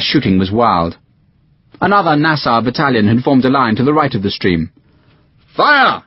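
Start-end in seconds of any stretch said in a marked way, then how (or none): 5.6-6.0 shouted command. Acted. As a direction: throw the order down the line. Second battalion fires volley.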